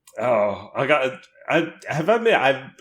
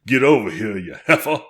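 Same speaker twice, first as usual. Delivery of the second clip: mock American; 5 dB louder than usual. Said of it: "his impersonation of an American was".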